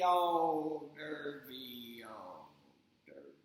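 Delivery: a battery dying mid-word; winding-down toy. Acted. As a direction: pitch and volume sinking to silence.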